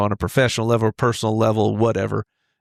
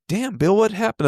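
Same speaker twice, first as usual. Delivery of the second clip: high startled exclamation; shocked; 9 semitones higher than usual. Retold or imitated. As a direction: pitch leaps up in alarm. Shocked at friend's rash.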